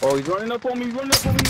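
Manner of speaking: high panicked yelp. Real, not acted. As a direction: panic as he closes in. Enemy rushing him.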